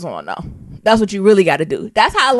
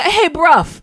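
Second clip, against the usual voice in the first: higher mock London "Hey, bruv." with a short u and final v; London slang; 8 semitones higher than usual. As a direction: imitate a London rapper greeting "Hey, bruv.". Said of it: "But he said".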